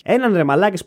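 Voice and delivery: ordinary delivery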